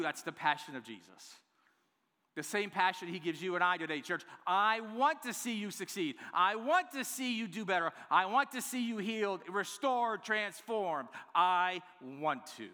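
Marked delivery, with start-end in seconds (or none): 4.5-12.7 high, loud, driving repetition; emphatic rallying cry, slowing at the end; impassioned preaching. Acted. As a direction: urge the congregation with full-voiced fervor. Preacher voices Jesus's passion to church.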